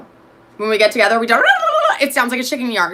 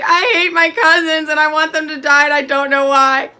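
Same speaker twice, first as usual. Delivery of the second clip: mock dramatic kid; 5 semitones higher, 4 dB louder than usual. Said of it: "I'm always like".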